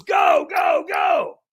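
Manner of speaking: high, loud repeated command; urgent shouted send-off. Acted. Trainer sending walker onto the coals, urgent shout. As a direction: yell to launch a runner.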